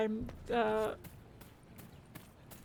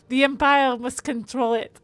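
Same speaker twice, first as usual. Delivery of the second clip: hammed-up French accent, louder; terrible French accent; 4 dB louder than usual. Streamer reading game dialogue to viewers in a terrible French accent. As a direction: read it in a badly faked French accent. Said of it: "my terrible French accent".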